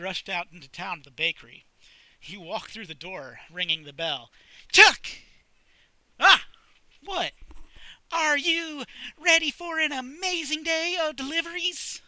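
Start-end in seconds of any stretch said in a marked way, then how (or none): 4.7-5.1 high, loud greeting yell; excited shout. Acted. Baker greeting Chuck at the door with a loud shout. 6.2-7.3 high, loud startled yelp. Acted. Chuck startled by the baker's yell, loud and high. 8.1-12.0 high, loud put-on baker voice; cheery baker. Baker hyping Chuck for deliveries, high and loud.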